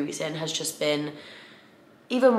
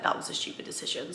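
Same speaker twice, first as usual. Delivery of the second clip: breathy whispered aside, much softer; wry whisper; 10 dB quieter than usual. Pretend. The universe mocking her choice to give away her running kit, in a whisper. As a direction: whisper it like a private joke.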